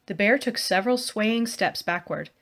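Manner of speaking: plain reading voice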